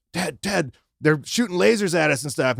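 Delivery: high, panicked call to a friend; freaked-out hallucinating whisper-shout; tripping friend panics at lasers. Retold or imitated. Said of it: "And I was like".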